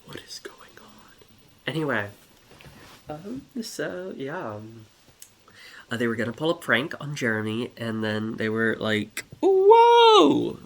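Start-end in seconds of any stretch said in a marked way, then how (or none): 0.0-1.2 hushed aside; whisper. Real, not acted. Narrator steps out of the story, whispering. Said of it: "broke character for a moment". 9.4-10.6 shrieked high, loud whoa. Acted. Pranking turtles cry out in a loud high whoa. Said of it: "Like that".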